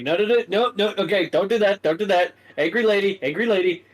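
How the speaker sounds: high, loud, frantic calming; frantic pleading. Acted. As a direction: hold her back, panicking. Liam holding back Stacy.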